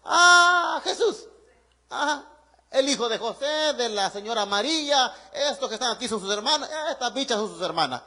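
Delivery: imitating gossipy, unimpressed neighbours; dismissive townsfolk. Nazareth neighbours gossiping about Jesus's family, mock-dismissive.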